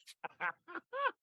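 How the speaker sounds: high-pitched burst of laughter; amused laugh. Real, not acted. Laughs at what people think yoga is.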